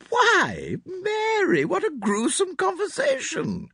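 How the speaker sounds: put-on high, loud, shocked elderly lady; Mrs Inglethorpe crying out. A narrator's reading. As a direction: exclaim in prim horror. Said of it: "cried Missus Inglethorpe".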